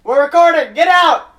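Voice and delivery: loud, high shout to get out; shouting them out. Real, not acted. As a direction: yell at the intruders. Shooing kids out mid-recording.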